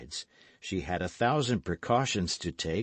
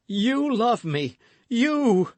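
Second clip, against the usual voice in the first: high, loud disbelieving joy; ecstatic cry; 7 dB louder, much slower than usual. Acted. Young lover crying out to the lady, high and loud. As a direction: cry it out, overwhelmed with delight. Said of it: "cried he".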